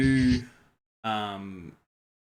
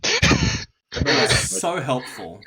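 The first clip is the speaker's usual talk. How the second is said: sarcastic; 4 semitones higher, 12 dB louder, much slower than usual. Real, not acted. Sarcastic reply to low roll.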